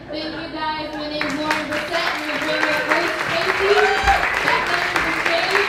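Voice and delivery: high, loud host call to the crowd; loud sign-off. Real, not acted. Comic signing off loudly to the cheering crowd. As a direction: belt it out over the applause.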